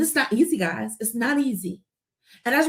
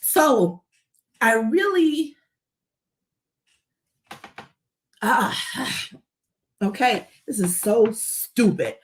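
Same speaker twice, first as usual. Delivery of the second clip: halting, sighing, struggling to go on; real, overcome with emotion; much slower than usual. Real, not acted. Speaker trying to compose herself on a live, emotional. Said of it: "I was gonna get this emotional".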